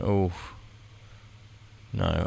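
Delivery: usual speaking voice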